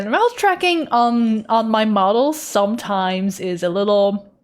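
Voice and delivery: normal talking voice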